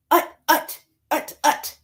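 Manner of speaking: short, high piggy grunts; high storybook pig sounds; pig noises. Acted.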